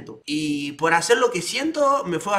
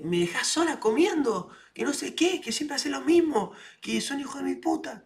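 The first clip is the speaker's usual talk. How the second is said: mocking girlfriend; 7 semitones higher, 4 dB quieter than usual. Girlfriend mimicked, high.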